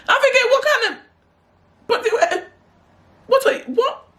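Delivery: very high, half-laughing squeal of disbelief; high-pitched, laughing splutter; incredulous squeal. Retold or imitated. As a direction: squeak it out, breaking up in bafflement.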